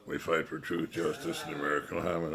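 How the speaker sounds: low, grave hero voice; deadpan like a serious teen hunter; mock heroic teen vigilante. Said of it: "two teenagers walking around going".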